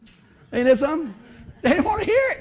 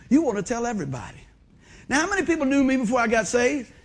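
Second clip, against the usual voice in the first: ordinary talk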